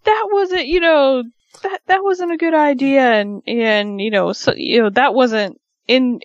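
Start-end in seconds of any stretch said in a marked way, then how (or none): 0.0-3.0 very high, mock-aggrieved protest; imitating unhappy complaint. Miz scolds the pranking women, imitated unhappy complaint. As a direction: play the sore loser, pitched way up. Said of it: "he's not happy".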